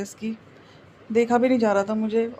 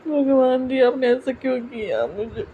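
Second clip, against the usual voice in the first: real tearful grief, raised voice; grief-stricken; 5 dB louder than usual. Real, not acted. Vlogger telling viewers her dog has died, grief-stricken. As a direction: choke out the news through sobs.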